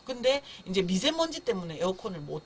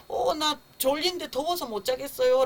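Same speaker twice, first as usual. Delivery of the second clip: lightly voiced baby, higher pitch; baby's complaint; 6 semitones higher, 3 dB louder than usual. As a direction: put the cry into words as a sleepy, hot baby. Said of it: "제가 울음소리를 들어보면". (살짝 높인 아기 투정 말투)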